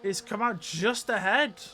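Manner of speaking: loud, drawn-out excited call. Real, not acted. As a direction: call it out like the race decider. Commentator calls the pit exit.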